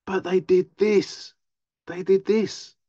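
high, slow insistence on did this; insistent protest. Retold or imitated. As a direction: hammer home what they did.

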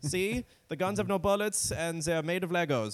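put-on high-pitched comic German accent; Germany personified. Germany proving it has no army, mock German. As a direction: show off harmlessness in a silly German voice. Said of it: "Germany for so long has been like".

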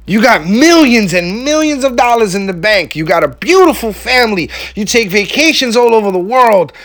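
incredulous disbelief. Retold or imitated. Telling viewers how he'd react to a rich CEO, mock incredulous. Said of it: "you would look at some of these people like".

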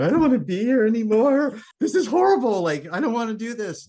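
high, loud wailing complaint, half-laughing; mock crying. Retelling breaking down on the board, a high whiny mock wail. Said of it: "started crying and going".

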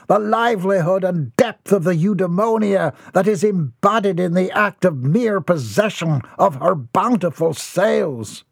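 high, grandiose rapturous raving; lofty, dreamy rant about a ship; ranting old eccentric. A narrator's reading. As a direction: lose yourself in a florid daydream.

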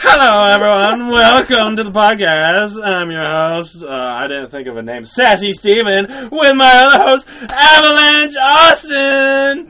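radio host intro. Pretend. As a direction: belt it out like an over-the-top podcast host. Playing a podcast radio host.

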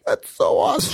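high-pitched, choked-up mock delight; retold tearful gushing; about to cry. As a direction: imitate someone tearing up at happy news. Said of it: "She starts to cry".

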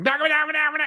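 high-pitched nonsense syllables imitating Vietnamese; mock Vietnamese chatter. Imitating nail salon staff talking together, mock Vietnamese chatter. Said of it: "they're talking to each other in Vietnamese".

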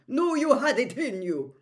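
loud, high boom of praise. A narrator's reading. Headmaster cheering his champion over the applause, booming. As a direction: boom it so everyone hears. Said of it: "boomed Karkaroff".

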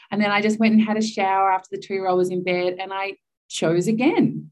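ordinary talk, nothing marked

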